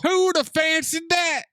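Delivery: high put-on Southern voice; exaggerated Southern accent, pitched high; mock Southern accent. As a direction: exclaim it in a hammed-up Southern twang. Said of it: "decides to just do an accent".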